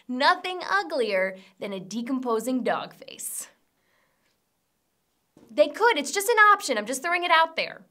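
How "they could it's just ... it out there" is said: higher and louder, protesting; defensive. Real, not acted. Host defending a tasteless joke, raised and defensive. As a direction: insist it's just an idea. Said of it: "defending the joke".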